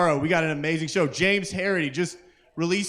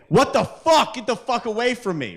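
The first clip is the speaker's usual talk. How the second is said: retold yell; 7 semitones higher than usual. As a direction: shout it at the stranger in the car. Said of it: "and I say".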